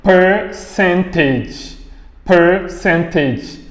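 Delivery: "Percentage" slowly, stretching "Per" without an R; long vowel, silent R. Shown as a demonstration. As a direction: hold the first vowel of "Percentage", then on to the consonant. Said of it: "on continue avec la consonne".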